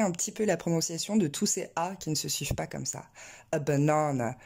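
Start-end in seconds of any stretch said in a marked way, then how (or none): 3.5-4.4 BANANA as buh-NAA-nuh, middle syllable long; English word stress. Shown as a demonstration. Teacher placing the stress in the right spot, English-stressed banana. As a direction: stretch the middle of BANANA, outer syllables weak. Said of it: "mettre l'accent tonique au bon endroit".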